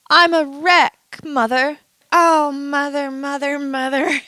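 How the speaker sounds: high, hammed-up cry of mother; melodramatic wail. Vlogger hamming up feeling unwell, calling mother. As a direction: wail for mother like a tragic heroine.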